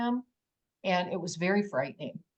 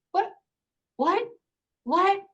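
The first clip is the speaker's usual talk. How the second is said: short, high, disbelieving questions; bewildered; 12 semitones higher, 3 dB louder than usual. Retold or imitated. Woman finds herself back by her car, acted-out bewilderment. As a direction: act out stunned confusion, each word pitched up.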